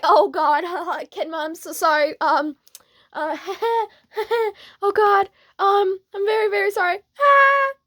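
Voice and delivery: high, loud mortified apology; nervous giggles between frantic apologies; flustered panic. Acted. Mortified apology after blurting aloud.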